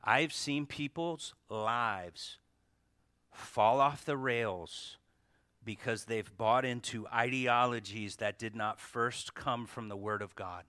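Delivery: ordinary delivery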